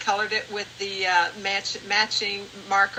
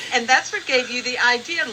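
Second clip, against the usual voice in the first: ordinary delivery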